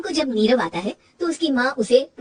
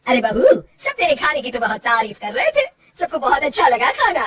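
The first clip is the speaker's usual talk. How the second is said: loud, pleased mother-in-law passing on praise; praising the bahu's cooking; 3 semitones higher, 6 dB louder than usual. Acted. (खुश सास की तारीफ़)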